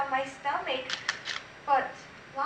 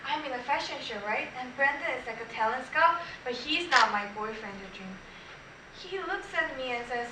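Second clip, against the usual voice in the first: ordinary talk, nothing marked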